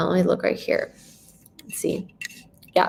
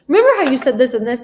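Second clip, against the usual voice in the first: monotone rushed demo; 6 semitones higher, 8 dB louder, faster than usual. Shown as a demonstration. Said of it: "if you just do this monotone".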